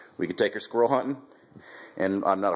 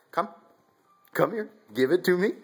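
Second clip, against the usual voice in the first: coaxing dog; 10 semitones higher than usual. Real, not acted. Coaxing puppy to hand bird.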